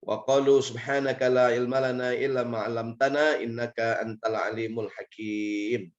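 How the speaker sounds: chanted Arabic recitation; Quran recitation. Acted.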